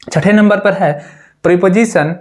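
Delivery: ordinary talk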